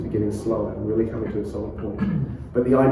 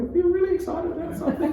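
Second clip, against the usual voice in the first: much higher, fast and laughing; excited; faster than usual. Shown as a demonstration. Coach showing an excited inflection. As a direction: lift it up with excitement. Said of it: "inflection of tones".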